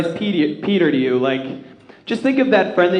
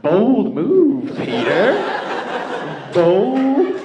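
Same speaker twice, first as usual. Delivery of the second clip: mock-impressed drawl; 4 semitones higher, much slower than usual. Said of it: "I was like".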